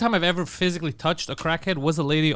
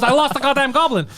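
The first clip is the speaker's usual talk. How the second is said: laughing mock complaint; 6 semitones higher, 5 dB louder than usual. Laughing retelling.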